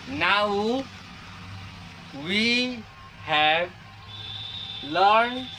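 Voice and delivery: spaced-out words for the class to copy; slow dictation. Acted. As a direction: spell it out slowly, one word at a time. (रुक-रुककर धीरे बोलना)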